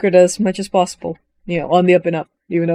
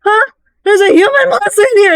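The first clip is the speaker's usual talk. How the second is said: much higher, alarmed cry of discovery; alarmed shock; 14 semitones higher, 4 dB louder than usual. Retold or imitated. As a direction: panic at what you just saw. Character alarmed at human monster.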